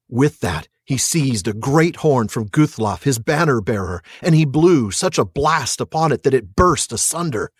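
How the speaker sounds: measured storyteller reading aloud; steady audiobook narration; story narration. A narrator's reading. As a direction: read it like an epic tale.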